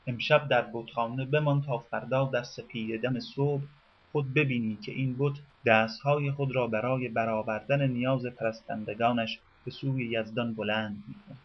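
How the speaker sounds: ordinary delivery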